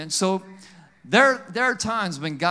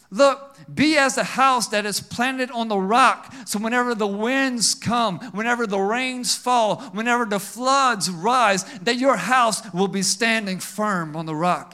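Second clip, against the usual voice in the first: preach; 3 semitones higher, 3 dB louder than usual. Acted. Preaching at full force.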